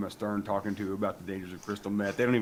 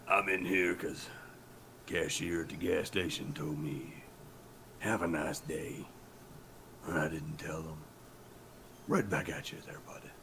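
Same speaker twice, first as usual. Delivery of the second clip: Canadian prisoner; 7 semitones lower, 7 dB quieter than usual. Acted. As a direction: play a grave, low-voiced prisoner. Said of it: "they cut to the prison, and they're like".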